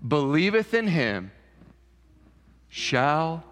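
slow, deliberate scripture reading over music; solemn recitation. Acted. Preacher reciting John 3:16 to the congregation, slow and solemn. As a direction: lay each word down gently.

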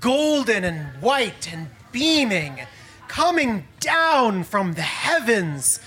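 slow, soft descriptive telling; slow awed narration. A narrator's reading. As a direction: paint the angel in awe. Describes the angel's descent.

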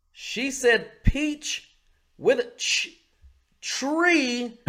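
high, drawn-out "peach", gap, "tree"; exasperated slow split of "peach" and "tree"; separated words. Retold or imitated. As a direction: hit the ch in "peach", then "tree" alone. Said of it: "She separated it out".

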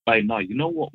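normal talking voice

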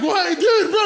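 high, loud disbelief; mock incredulous. Driver to cholos discussing the injured man, high incredulous yelp. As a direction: yelp it at them in mock alarm.

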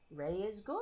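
very soft reassurance to the cat; soothing cat. Real, not acted. Soothing the cat softly. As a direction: hush the cat gently. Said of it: "That's what I have to do all day".

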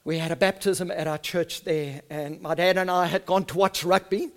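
ordinary talk

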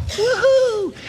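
put-on kid's joyful whoop. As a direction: whoop like a thrilled little kid.

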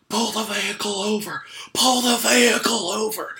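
high, very loud imitated yell; furious mock shout. Go-kart worker ordering a kid to stop, imitated furious shout. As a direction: bellow it like an irate track worker. Retold angry man stopping kart.

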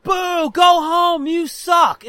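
loud, high, drawn-out boo; heckling. Retold or imitated. Kid watching a bad ball game, heckling loudly. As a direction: jeer them off the field. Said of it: "But I'm heckling".

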